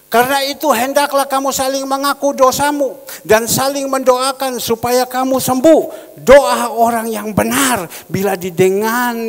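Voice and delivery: loud, high, declamatory reading; scripture proclamation. Acted. Verse read out for the congregation, loud and declamatory. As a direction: ring it out to the whole church.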